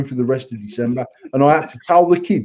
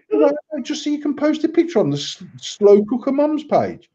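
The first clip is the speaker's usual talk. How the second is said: exasperated rant; 10 semitones higher than usual. Retold or imitated. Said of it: "And I'm like".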